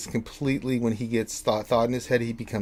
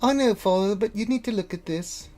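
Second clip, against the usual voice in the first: raised-pitch, pressing character voice; insistent daughter; 9 semitones higher than usual. Acted. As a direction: urge your distracted father to look. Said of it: "she's like".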